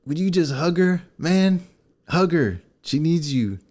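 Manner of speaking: mock pastor urging him to hug her; imitating the pastor's urging. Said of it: "and he's like".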